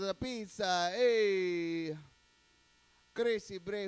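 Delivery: ordinary talk, nothing marked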